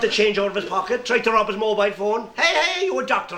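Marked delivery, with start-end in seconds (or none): 2.4-3.4 high startled hey; high startled cry at being robbed; shocked protest. Acted. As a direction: catch him in the act. Patient catching the robber doctor.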